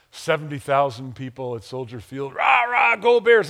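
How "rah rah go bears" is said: mock stadium cheer, high and loud; crowd cheer. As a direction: chant it like a Bears fan. Imitating a football crowd cheering.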